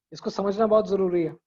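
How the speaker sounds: ordinary talk, nothing marked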